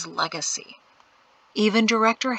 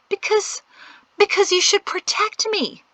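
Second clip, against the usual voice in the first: high, whiny pleading voice; pleading girl; 13 semitones higher, 4 dB louder than usual. Acted.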